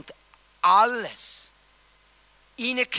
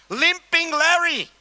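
Limping Larry voice; 5 semitones higher, 3 dB louder, slower than usual. Acted. Names the leper nickname.

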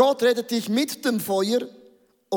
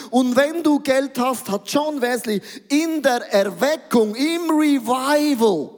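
full-force preaching; 4 semitones higher than usual. Acted. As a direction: drive the message home to the church. Predigt mit voller Kraft. (laut predigend, mit voller Kraft)

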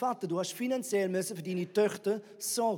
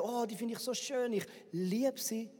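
a hammed-up sigh of infatuation; mock lovestruck; 7 dB quieter than usual. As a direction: gush over her like a smitten groom. Mock lovestruck quote of Samson.

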